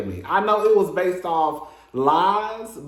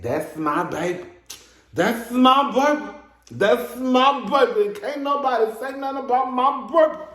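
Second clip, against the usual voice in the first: higher-pitched doting mama impression; mock mama-bear insistence, pitched up; fiercely protective mama; 8 semitones higher than usual. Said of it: "one of those mamas like".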